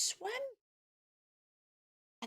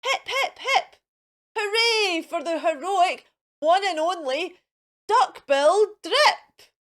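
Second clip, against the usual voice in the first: high, loud hooray; joyful hooting cheer; 6 semitones higher, 8 dB louder than usual. Acted. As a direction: cheer the hero on. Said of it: "They hooted".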